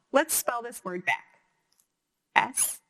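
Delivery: normal talking voice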